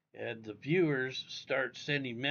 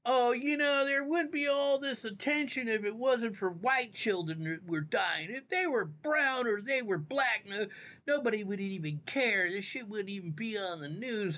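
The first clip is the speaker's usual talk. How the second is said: high, mocking whiny voice of the woman; 9 semitones higher, 5 dB louder than usual. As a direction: sneer at her words in a high nasal-free mock voice. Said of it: "fucking talking shit, like".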